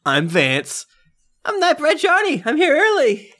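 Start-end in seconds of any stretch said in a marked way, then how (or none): 1.5-3.3 much higher, squeaky little voice; high squeaky character voice. Acted. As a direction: squeak it like a nervous little man. GM voices Nightbread Johnny.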